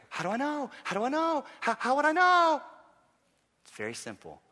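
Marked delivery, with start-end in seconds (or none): 0.1-2.6 high-pitched, rising mock helplessness; mock fretting. Preacher mimicking anxious doubters, high mock fretting. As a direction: imitate a worrier, pitch climbing with each repeat.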